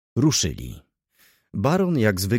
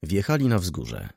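plain reading voice

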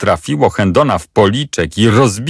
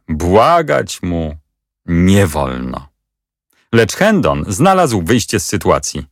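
plain reading voice

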